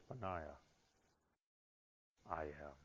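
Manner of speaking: very soft, low revelation; almost hush it, low and solemn; voice of Jesus. Acted. Jesus appearing to Benaiah, hushed and solemn.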